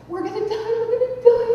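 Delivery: high-pitched panicked wail; hammed-up doom shriek; hysterical screaming. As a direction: reenact interrupting in terror. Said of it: "I'm hysterically screaming and crying".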